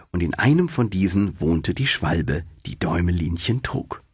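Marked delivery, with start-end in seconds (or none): none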